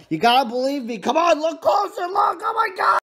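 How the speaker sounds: high, loud, building pleading; mock frantic shout. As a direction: escalate into an over-the-top shout. Begging viewers to look closer.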